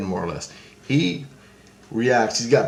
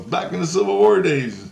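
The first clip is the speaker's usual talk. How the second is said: lightly raised imitation of Christ; high voice of Christ; 8 semitones higher, 4 dB louder than usual. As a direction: voice him high, explaining. Christ voiced in dream story.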